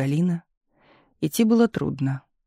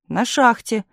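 higher-pitched answer; defensive; 6 semitones higher, slower than usual. A narrator's reading. Telegraph operator answering chief's accusation, defensive. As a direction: answer back in a raised pitch.

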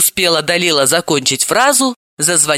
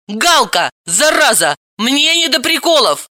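higher-pitched angry cry; moderately raised voice; shouted; 7 semitones higher than usual. A narrator's reading. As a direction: yell crossly into the phone. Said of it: "прокричала".

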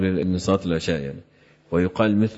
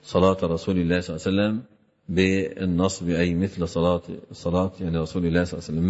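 ordinary talk, nothing marked

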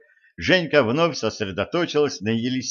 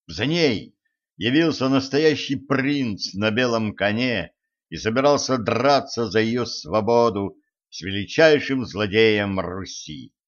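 ordinary delivery

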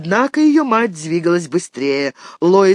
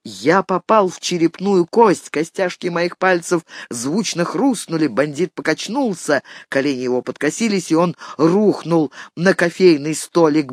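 plain reading voice

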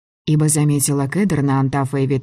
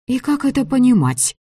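high-pitched, suspicious demand; 10 semitones higher than usual. A narrator's reading. Girl questioning the director's hint, suspicious. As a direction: question him warily, pitch raised. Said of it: "Я прищурилась".

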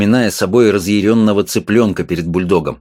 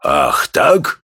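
professor's threat; much slower than usual. A narrator's reading. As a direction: threaten her with mock gravity. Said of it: "промолвил он". (профессор медленно, с угрозой)

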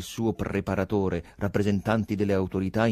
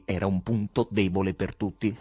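plain reading voice

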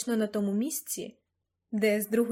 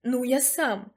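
a brief, lightly raised outburst; mild exclamation; 2 semitones higher, 4 dB louder, slower than usual. A narrator's reading. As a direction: exclaim it with a little burst of force. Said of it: "скрикнув Лукас". (легкий вигук)

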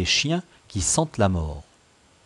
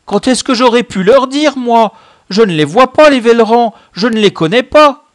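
high, loud self-defence; protesting; 13 semitones higher, 13 dB louder than usual. A narrator's reading. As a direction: plead innocence at a shrieking pitch. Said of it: "protestait de nouveau Baccaillé". (protester d'une voix aiguë, affolée)

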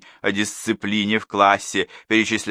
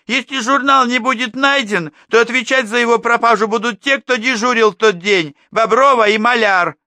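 much higher, louder stern threat; angry teacher; 8 dB louder than usual. A narrator's reading. Class teacher blames the pupils on duty, high and stern. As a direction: lay down the punishment.

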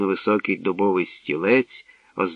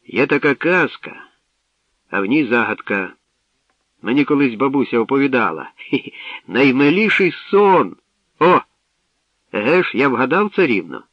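higher, louder boastful suitor with a giggle; self-satisfied voivode; 5 semitones higher, 4 dB louder than usual. A narrator's reading. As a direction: tell it triumphantly, chuckling.

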